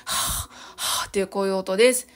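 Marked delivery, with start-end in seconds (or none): 0.0-1.1 "はぁ" as pure breath with no voice; "はぁ" demonstrated as voiceless breath; breathy h sound. Shown as a demonstration. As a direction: exhale "はぁ、はぁ" unvoiced like a sigh. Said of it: "息を使って出す音ですね".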